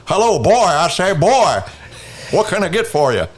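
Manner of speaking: blustery cartoon rooster voice; Foghorn Leghorn. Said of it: "do your foghorn leghorn".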